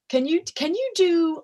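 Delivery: high-pitched, hesitant mock director; lightly mimicking an out-of-the-blue request; imitated casual request. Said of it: "they just out of left field say".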